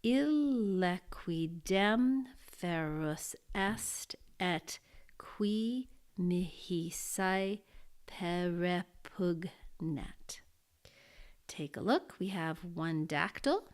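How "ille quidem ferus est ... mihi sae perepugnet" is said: Latin verse read very slowly, each syllable weighed; slow, deliberate recitation of a Latin hexameter; slow scansion reading. Shown as a demonstration. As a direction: recite it like measured poetry, beat by beat. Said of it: "I'm gonna scan this line here".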